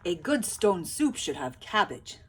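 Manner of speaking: loud hearty soldier; soldiers' voice. A narrator's reading. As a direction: announce it like a soldier. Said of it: "said the soldiers".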